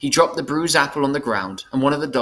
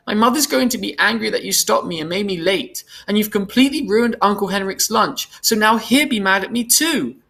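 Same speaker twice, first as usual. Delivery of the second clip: put-on high-pitched tearful child's complaint; acted crying little-girl protest; choked crying; 10 semitones higher, 3 dB louder than usual. A narrator's reading. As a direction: wail the grievance up high, on and on. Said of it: "her voice choked".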